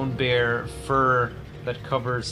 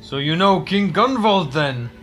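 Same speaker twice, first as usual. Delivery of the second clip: tribesman voice; 5 semitones higher, 7 dB louder than usual. Acted.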